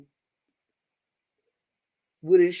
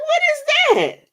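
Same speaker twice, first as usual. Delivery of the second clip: much higher, louder baffled question; 6 dB louder, much faster than usual. Retold or imitated. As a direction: squeal it in total disbelief. Baffled by aunt's saying.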